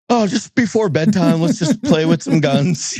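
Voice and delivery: lifts way up, light and jokey; mock cheerful chirp; playful high voice. Playful self quote, high.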